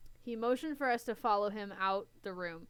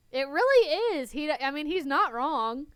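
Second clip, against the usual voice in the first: loud insistence; 7 semitones higher, 9 dB louder than usual. Acted. Derek backing up angrily.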